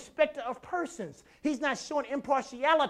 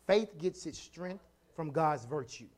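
slow dictation; 5 semitones lower, 6 dB quieter than usual. Shown as a demonstration. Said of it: "Write that down".

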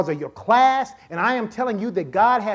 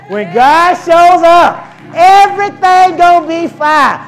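much louder and higher, slow and ringing; full-force preaching; 8 semitones higher, 12 dB louder, slower than usual. Acted. Preacher repeats to congregation, roaring. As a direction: bellow the promise to the church.

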